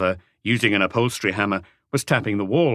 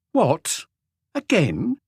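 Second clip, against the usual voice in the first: sharp, higher surprised outburst; an annoyed wife caught off guard, exclaiming; 9 semitones higher, much slower than usual. A narrator's reading. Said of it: "she exclaimed".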